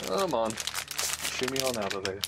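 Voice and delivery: normal talking voice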